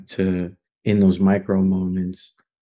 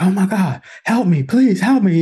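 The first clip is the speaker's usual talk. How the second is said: high, loud panicked begging; frantic plea; 12 semitones higher, 5 dB louder than usual. Retold or imitated. Patient pleading with staff, high panicked voice. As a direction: beg for help like a frantic patient.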